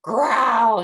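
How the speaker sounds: loud gruff growl; bear growl. A narrator's reading. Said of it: "said the bear".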